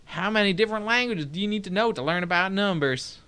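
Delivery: ordinary delivery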